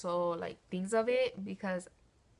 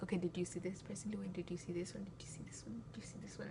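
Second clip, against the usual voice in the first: 1 whispered; 13 dB quieter, much faster than usual. Retold or imitated. Teacher questioning each kid.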